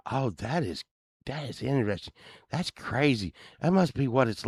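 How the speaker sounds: high, marvelling neighbor; old neighbor's voice. Retold or imitated. Neighbor amazed by rock band life, high wondering voice. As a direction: naive wonder of a henpecked husband. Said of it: "he talked like this".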